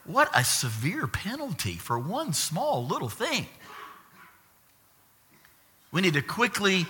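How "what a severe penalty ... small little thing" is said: higher, softer, swooping disbelief; mock-incredulous complaint; incredulous objection. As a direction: protest it in a singsong, high voice. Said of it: "you're like".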